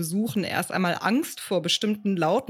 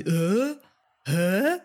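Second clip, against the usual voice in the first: puzzled questioning; 3 semitones higher than usual. Acted. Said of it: "Jeder kann so etwas sagen wie".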